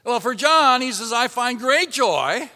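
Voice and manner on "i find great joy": joyful proclamation. Acted. Reads John's joyful greeting.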